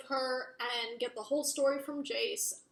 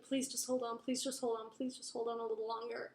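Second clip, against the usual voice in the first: hushed retold begging, getting softer and faster; soft pleading; 6 dB quieter, faster than usual. He begs his poisoned love to hold on, soft acted plea. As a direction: beg under your breath, acted out.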